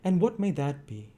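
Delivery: matron asking. A narrator's reading. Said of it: "asked the matron".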